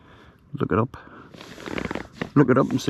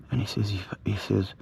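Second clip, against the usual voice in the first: spooky whisper; 3 semitones lower, 8 dB quieter than usual. Real, not acted. Telling viewers a ghost story, whispering. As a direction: drop to a hushed whisper.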